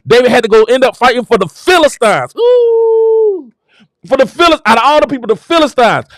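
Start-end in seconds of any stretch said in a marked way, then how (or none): 2.4-3.5 long, very high "ooh"; high sustained whoop. Real, not acted. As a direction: overcome preacher's falsetto cry. Overcome exclamation while preaching.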